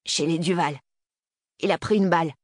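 plain reading voice

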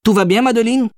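very high-pitched voice of a concerned employee; employé qui se reprend. A narrator's reading. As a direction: correct yourself and ask again, voice pitched right up. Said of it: "se reprit l'Asiatique". (voix très aiguë d'employé qui se reprend)

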